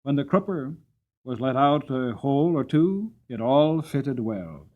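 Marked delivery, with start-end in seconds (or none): none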